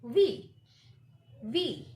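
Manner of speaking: careful falling V; lip-biting V. Shown as a demonstration. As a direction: buzz V clearly. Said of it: "pronounce this letter by biting".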